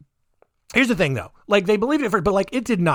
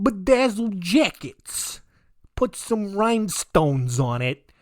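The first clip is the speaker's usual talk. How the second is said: hammed-up New York accent, mostly higher; put-on New York accent; 4 semitones higher than usual. Brigitte convinces Tony to open a store, mock New York accent. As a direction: pitch a scheme in exaggerated New Yorkese. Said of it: "hope she did talk like that".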